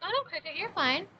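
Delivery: high soft reassurance; reassuring. Real, not acted. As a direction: soothe the chatter gently. Reassuring a chatter.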